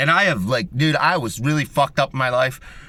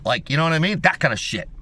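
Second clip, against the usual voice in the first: usual speaking voice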